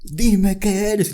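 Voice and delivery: high, very slow, drawn-out demand; mock interrogator. Host acts out the world interrogating the athlete, high and dragged out. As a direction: stretch it out like a pushy questioner. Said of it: "esa es la imagen".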